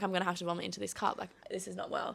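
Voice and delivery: sick and faint, barely voiced; queasy murmur. Sick friend quietly dreading she'll vomit. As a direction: mutter it like about to throw up. Feeling sick at festival, quoted.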